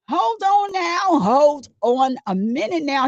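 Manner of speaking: slow, high, drawn-out disbelief; stunned hold on. Real, not acted. Slowly stopping the witness in disbelief. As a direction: stretch it out, stopping the story. Reacting to testimony.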